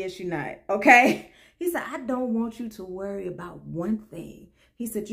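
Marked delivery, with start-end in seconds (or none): 1.9-4.5 low, soft retold reassurance; calm reassurance. Guard telling a frightened woman not to worry, calm reassurance. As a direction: promise it gently and firmly, voice lowered.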